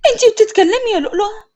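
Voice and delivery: tearful surprise. Acted. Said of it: "سندس ببكاء ومفاجأة".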